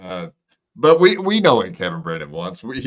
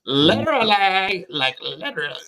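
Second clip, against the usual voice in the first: put-on Valley Girl voice; 9 semitones higher than usual. Shown as a demonstration. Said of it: "I'm sounding like a Valley Girl".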